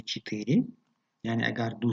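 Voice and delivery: usual speaking voice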